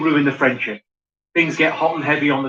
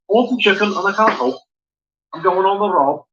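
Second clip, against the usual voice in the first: authentically Scouse. Shown as a demonstration. Comedian shows off something properly Scouse, in accent. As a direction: put on a mock Scouse accent. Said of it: "authentically Scouse".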